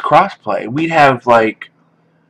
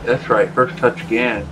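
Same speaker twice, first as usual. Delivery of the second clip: ordinary talk, nothing marked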